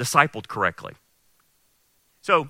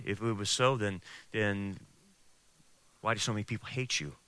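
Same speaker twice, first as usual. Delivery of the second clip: very low, hushed tempter inside the head; voice of doubt; 7 semitones lower, 11 dB quieter than usual. Acted. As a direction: wear them down in a soft, sunken murmur. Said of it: "the war that's going on inside my head".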